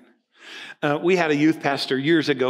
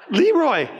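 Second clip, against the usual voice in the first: high, loud "Leroy!" in mock scolding; exasperated call; 11 semitones higher, 7 dB louder than usual. Pastor scolding his friend Leroy, high and loud. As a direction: call his name like you can't believe him.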